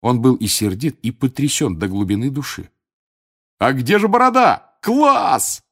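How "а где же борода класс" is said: loud, high excited roar; whooping, high-pitched shout. A narrator's reading. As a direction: bellow with delighted amazement. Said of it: "взревел Джордж".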